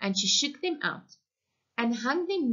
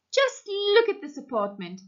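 motherly woman voice; 11 semitones higher, 3 dB louder than usual. A narrator's reading. Mrs Hager in mess.

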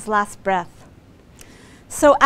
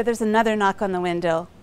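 ordinary talk, nothing marked

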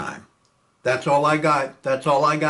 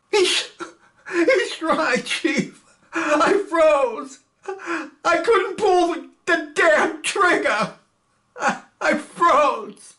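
high, tearful, choking on the words; crying; 14 semitones higher, 3 dB louder, slower than usual. Acted. Frightened gunner confessing to his chief, crying. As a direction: sob out the confession. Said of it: "Milstein crying on the floor".

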